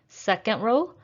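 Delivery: ordinary talk, nothing marked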